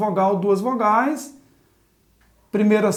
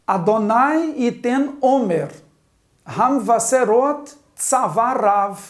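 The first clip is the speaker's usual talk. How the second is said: slow, word-by-word Hebrew reading; leitura pausada; slower than usual. Shown as a demonstration. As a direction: read it out slowly in separate chunks for learners. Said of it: "Vamos à leitura?". (leitura didática, lenta e pausada)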